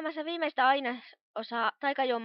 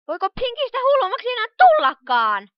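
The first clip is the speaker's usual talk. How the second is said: rising excitement; 9 semitones higher, 13 dB louder than usual. Acted. Twilight gets carried away.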